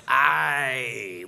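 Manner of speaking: a low Scottish "Aye"; a Scottish guy's "Aye". Retold or imitated. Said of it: "which is yes in Scottish".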